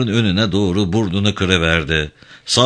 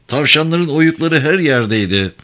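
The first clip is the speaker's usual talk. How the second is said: higher-pitched protesting call; 6 semitones higher than usual. A narrator's reading. Driver tells Craig about the burrows, calling out. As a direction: keep the voice raised high in protest. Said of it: "diye seslendi Salien".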